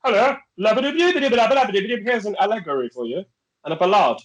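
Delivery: high-pitched, babbling country gent; hammed-up gentleman of the countryside. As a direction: gabble it high and fast, like a countryside toff. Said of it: "Like a, a gentleman of the countryside".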